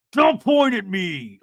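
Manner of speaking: loud, slow mock command; mock angry order. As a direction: imitate a heated warning, drawn out. Acting out a snapped order.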